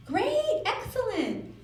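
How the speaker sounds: very high, gushing mom praise; praising mother. Acted. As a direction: cheer a small child on in a high voice. Mother praising child on swing.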